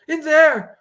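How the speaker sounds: high, loud frightened cry; panicked. Acted. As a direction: point and shriek. Frightened woman points at trailer.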